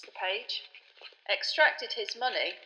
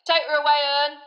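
louder call, higher, bossing the driver; loud order; 7 semitones higher, 10 dB louder than usual. Acted. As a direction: shout it to the driver over the engine.

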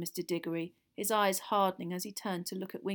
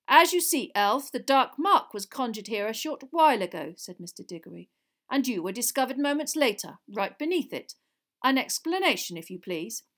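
louder, lightly acted official voice; stern interrogator; 3 semitones higher, 8 dB louder than usual. A narrator's reading. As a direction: question the accused with authority. Diggory interrogates the cowering elf.

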